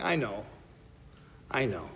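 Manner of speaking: soft, low, gently soothing; gentle Jesus. Retold or imitated. Jesus soothing frightened disciples, soft and low. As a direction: hush their fears like a tender teacher.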